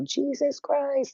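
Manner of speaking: high, slow startled cry; mock scared scream. Her scream at her dad in the dream, retold. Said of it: "and then I screamed out".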